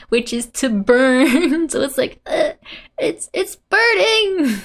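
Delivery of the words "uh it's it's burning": high, drawn-out stammering wail; stammer, then cry out in mock panic; panicked stutter. Torako saying her car is burning, panicked stutter. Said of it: "she's stuttering from her moeru".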